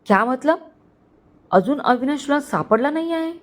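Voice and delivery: annoyed boss raising the voice a little; mild shout. A narrator's reading. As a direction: lightly yell in disbelief. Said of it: "Aarav हलकं ओरडून बोलला".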